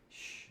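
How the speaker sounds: sh held softly, tongue behind teeth; sh sound. Shown as a demonstration. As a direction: hush out a long sh. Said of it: "Then there's the sh sound".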